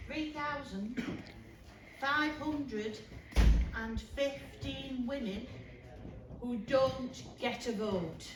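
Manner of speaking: slow, separated phrases; emphatic oratory. Acted. Campaigner drives home women's missing vote, slow emphatic repetition. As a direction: hammer out the figures with pauses between. Rhetorical repetition in a rally speech.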